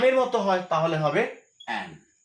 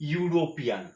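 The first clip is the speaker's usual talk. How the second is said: usual speaking voice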